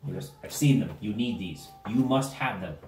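low, measured, insistent gnome; earnest mad gnome. Acted. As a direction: press each instruction on them gravely. Gnome gives urgent instructions.

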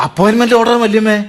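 high and eager; excited. Acted. Son showing aunt his appointment order, thrilled. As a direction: wave the letter proudly.

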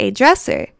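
ordinary talk, nothing marked

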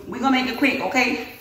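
ordinary delivery

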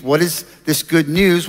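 ordinary delivery

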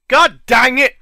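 a loud, real burst of frustration; angry outburst. Real, not acted. Gamer yelling at the snow in his way, loud and annoyed. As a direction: snap it out loud at the game.

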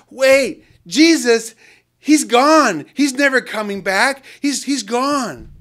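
high, loud, emphatic denial; insistent protest. Retold or imitated. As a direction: insist he's gone for good. Homeless man protests Jesus is gone.

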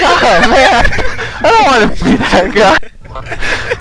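high, drawn-out comic whine; mock-agonized through laughter; laughing dismay. Driver dreading breaking the news to a kid, laughing dismay.

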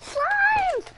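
high, loud thrilled cry; excited shout. Real, not acted. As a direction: shout it out with delight. Kid spots slimes in game.

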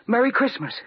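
the message finally clicks; joyful discovery. Acted.

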